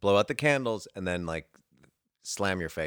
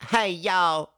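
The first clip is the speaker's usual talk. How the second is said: put-on Southern accent; 10 semitones higher, 3 dB louder than usual. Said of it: "whip out a Southern accent".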